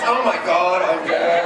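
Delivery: lightly low sultry voice; a hint of seductive purr; sexy voice. Shown as a demonstration. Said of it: "Down your sexy voice".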